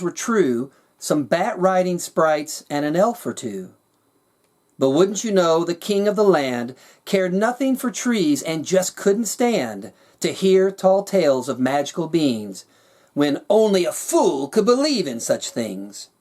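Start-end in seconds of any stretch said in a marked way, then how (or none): none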